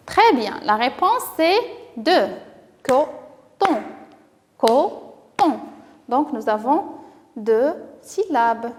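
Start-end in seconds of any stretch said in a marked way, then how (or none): 2.8-3.8 "Coton" split into co, pause, ton; syllable by syllable. Shown as a demonstration. Teacher corrects the syllable count for children, syllable by syllable. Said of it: "découper chaque mot". 4.6-5.6 "Coton" split into co, pause, ton; syllable by syllable. Shown as a demonstration. Teacher corrects the syllable count for children, syllable by syllable. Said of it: "découper chaque mot".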